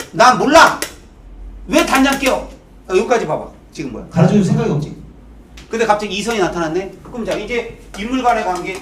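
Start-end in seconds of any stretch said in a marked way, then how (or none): 0.1-2.5 loud, higher-pitched mock irritation; acted grumpy refusal. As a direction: brush them off loudly. Acting out the old man's refusal. (큰 소리로 짜증 내는 척)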